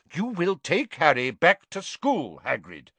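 firm, final instruction; firmly. Acted. Dumbledore to Hagrid, firmly repeating. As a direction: leave no room for argument. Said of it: "Dumbledore repeated firmly".

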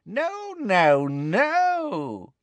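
high, swooping, drawn-out villainess; Bellatrix. A narrator's reading. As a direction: savour each word in a high swoop. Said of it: "said Bellatrix".

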